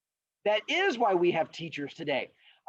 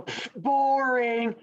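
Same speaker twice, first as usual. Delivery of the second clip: higher, very drawn-out dismissal; mock bored kids; 6 semitones higher, 3 dB louder, much slower than usual. Kids heckle the old man's story, exaggerated boredom. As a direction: heckle with a long, slow jeer.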